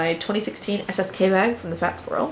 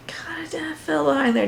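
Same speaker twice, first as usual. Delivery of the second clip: whispered aside; 6 semitones higher than usual. Real, not acted. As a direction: mutter it to yourself.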